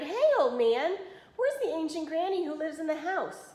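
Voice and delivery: high, loud call across a field; impatient shout. A narrator's reading. Impatient wolf shouts to a farmer on a tractor, calling out high. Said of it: "he shouted".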